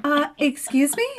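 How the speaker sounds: high, loud mock indignation; wife bristling at husband's cheerful dinner question; indignant excuse me. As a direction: act offended, pitch up. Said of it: "And I'm like".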